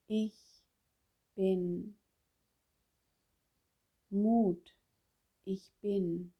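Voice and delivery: slow, soft affirmation with long pauses; meditative affirmation. Shown as a demonstration. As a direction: let each word sink in, quietly and slowly. Spricht Affirmationen zum Nachspüren vor. (leise, langsame Affirmation mit langen Pausen)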